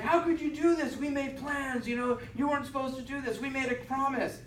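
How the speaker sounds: high-pitched angry protest; mock angry quote. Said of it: "really angry saying it".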